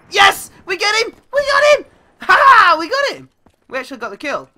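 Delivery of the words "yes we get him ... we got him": loud, high, elated cheer; triumphant yelling. Real, not acted. Gamer finally getting a sniper kill, yelling in triumph. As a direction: shout it high in celebration.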